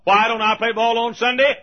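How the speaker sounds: high, loud preacher's question; emphatic rhetorical question. Real, not acted. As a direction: call it out to the congregation. Rhetorical question thrown at congregation.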